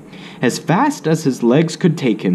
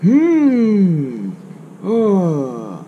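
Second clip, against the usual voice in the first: higher, drawn-out thinking hum; Grandpa pondering; 5 semitones higher, much slower than usual. Acted. Grandpa thinking hard about Wilbur's question, pondering hum. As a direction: mull it over aloud.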